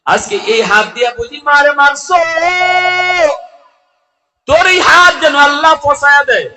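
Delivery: high, loud retold cry of reproach; impassioned shout, held at high pitch; mother's screamed curse. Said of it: "এমনি মা চিৎকার দিয়া কয়".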